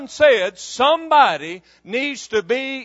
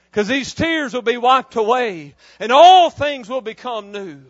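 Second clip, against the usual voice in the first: usual speaking voice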